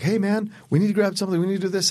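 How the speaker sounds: high-pitched imitation of an eager friend; nagging friend. Friend who calls every three months, imitated in a high nagging voice. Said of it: "I had a friend who calls me".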